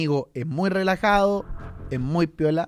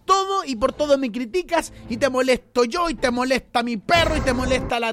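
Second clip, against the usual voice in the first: fed-up rant; 7 semitones higher than usual. Retold or imitated. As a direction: let it all spill out like the boyfriend snapping. Husband finally losing his temper.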